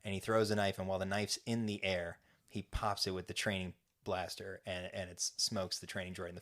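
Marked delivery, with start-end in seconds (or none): none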